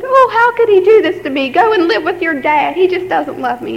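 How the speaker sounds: high, wailing mock lament; imitating mom crying. Daughter mimicking her crying mom's complaint, mock wail. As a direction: whine it like a hurt mother. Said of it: "my mom cried, said".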